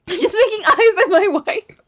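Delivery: giggling through the words, high-pitched; laughing. Real, not acted. Streamer giggling about making eyes at her wife, laughing. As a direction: laughs while confessing she's making eyes.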